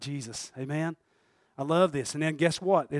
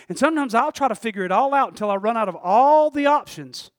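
ordinary talk, nothing marked

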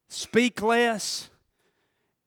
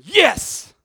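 sudden loud, gloating yes; a quick mock cheer of triumph; gleeful devil; 5 semitones higher, 9 dB louder than usual. Devil cheering as someone gets angrier, gleeful. The devil's sitting over there going.

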